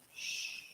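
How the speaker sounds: soft shush; hushing shush. Acted. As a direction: hush them very quietly. Hushes the listener.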